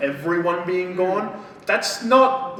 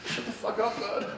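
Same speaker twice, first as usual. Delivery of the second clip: higher, hushed voice through laughter; muffled retort; 6 semitones higher, 7 dB quieter than usual. Retold or imitated. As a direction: squeeze out a small mock grumble, laughing. Muttered retort after the cheer.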